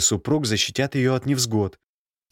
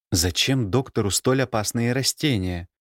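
ordinary delivery

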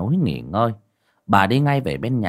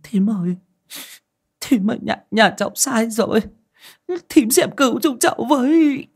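higher and rising, desperate begging; pleading; 13 semitones higher than usual. A narrator's reading. Bà Nối clutching the aunt's legs, begging for help. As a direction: beg for rescue, clinging on. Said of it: "miệng van xin".

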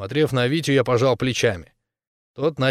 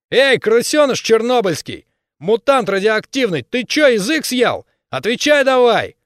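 high, loud jeering yell; berserker shouting; 10 semitones higher, 5 dB louder than usual. Acted. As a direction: bellow insults at the rival. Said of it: "он крикнул".

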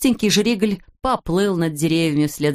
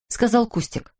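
ordinary delivery, nothing marked

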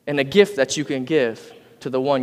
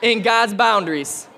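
higher, lifted prompt for the crowd to repeat; call and response; 6 semitones higher, slower than usual. Shown as a demonstration. Preacher leads the congregation in a higher, ringing repeat line. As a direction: lead the room, lift it high.

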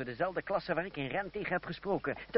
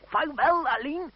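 much higher and louder called goodbye; calling out farewell; 12 semitones higher, 12 dB louder, slower than usual. Acted. Riding away, calling goodbye to his cousin. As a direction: call it back over the distance.

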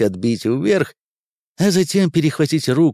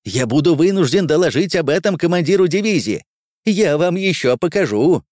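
higher-pitched angry warning; indignant threat; 6 semitones higher, 3 dB louder than usual. Acted. Quartermaster threatens to report scouts to commander, indignant. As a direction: threaten them, pitch kept up.

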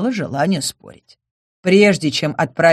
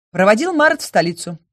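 plain reading voice

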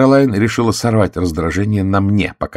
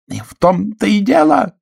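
high, ringing triumph; triumphant exclaim; 4 dB louder than usual. A narrator's reading. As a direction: crow over your win. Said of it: "торжествующе воскликнула Кэролайн".

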